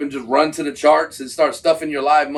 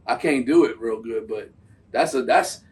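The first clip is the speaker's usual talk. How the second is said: usual speaking voice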